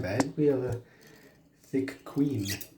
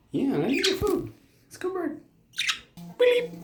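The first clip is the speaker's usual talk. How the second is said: much higher, squeaky pet voice. Real, not acted. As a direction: coo and chirp at the bird. Voicing for the budgie.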